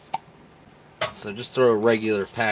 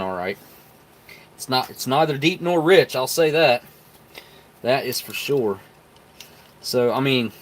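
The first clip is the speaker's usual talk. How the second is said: usual speaking voice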